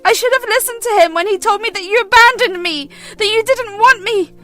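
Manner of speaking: put-on hurt teenage daughter lashing out; angry accusation. Daughter accuses her mother of abandoning her, furious.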